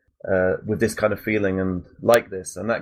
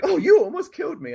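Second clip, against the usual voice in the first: much higher squealing put-on woman's voice; passenger impression. The passenger complaining after the crash, much higher. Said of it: "my impression of the stereotype".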